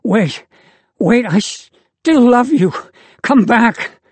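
high, loud, slow ragged plea; ragged pleading. A narrator's reading. The lover begs the departing girl, ragged loud plea. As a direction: beg her to come back, voice tearing. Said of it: "cries in a ragged voice".

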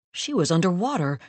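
ordinary delivery

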